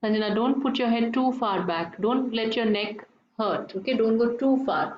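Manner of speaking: normal talking voice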